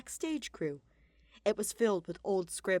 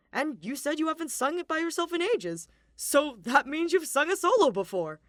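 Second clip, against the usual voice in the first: hearty man voice; 6 semitones higher, 7 dB louder than usual. Acted. Balthus reassuring.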